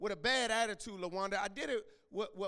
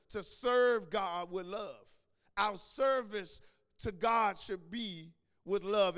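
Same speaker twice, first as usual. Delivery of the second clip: slow, louder, deliberate sermon line; slow emphatic preaching; 5 dB louder, slower than usual. Acted. Preacher spelling the lesson out to the church, slow and emphatic.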